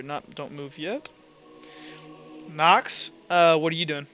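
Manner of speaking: usual speaking voice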